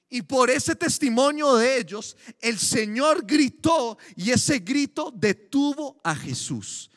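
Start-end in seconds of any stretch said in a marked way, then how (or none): none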